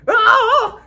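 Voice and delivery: high squawking cry; rooster squawk. Shown as a demonstration. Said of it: "imagine you're a cocker or a chicken".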